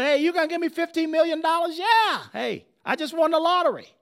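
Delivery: high-pitched, fast, giddy disbelief; thrilled seller. Acted. France jumping at Jefferson's land offer, thrilled. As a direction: crow like you've just won big. France acting delighted at the deal.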